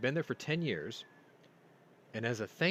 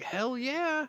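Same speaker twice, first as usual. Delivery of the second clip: much higher, gleeful yes; eager; 3 dB louder than usual. Retold or imitated. Answering the tank commander's offer, eager. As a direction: jump at the chance.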